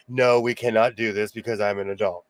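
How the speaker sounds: low, measured refusal; stern adult. Retold or imitated. Dad to son in non-friend moments, stern adult. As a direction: lay down the law as the grown-up. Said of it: "other moments where I'm like".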